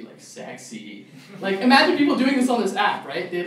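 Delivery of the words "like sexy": much lower, softer and slow; fake low sexy voice. Shown as a demonstration. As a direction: drop the voice to sound sexy. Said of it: "make their voice low to be".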